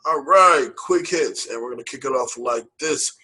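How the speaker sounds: normal talking voice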